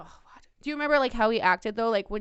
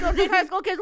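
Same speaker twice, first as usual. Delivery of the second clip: high, loud, hollered warning; emphatic shout; 8 semitones higher, 7 dB louder than usual. Real, not acted. Host warning an older creep off teenagers, shouted.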